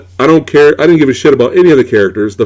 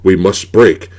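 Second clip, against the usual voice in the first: mock caveman talk; 7 semitones lower than usual. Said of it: "they have the stupid dialogue".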